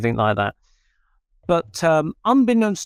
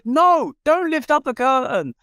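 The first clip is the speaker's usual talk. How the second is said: urgent warning; 11 semitones higher than usual. Retold or imitated. Wife stopping a guest lifting the curtain, alarmed cry. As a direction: stop him fast, voice jumping up. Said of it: "quickly the wife said".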